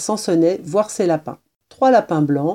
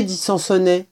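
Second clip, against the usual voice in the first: plain reading voice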